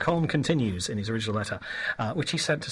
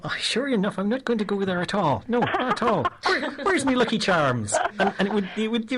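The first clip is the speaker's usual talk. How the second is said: high, lilting mock Irish; hammed-up Irish accent; 7 semitones higher than usual. Host proving his Irish accent would fail, mock Irish. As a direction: put on a deliberately bad Irish brogue. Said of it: "You know my Irish accents".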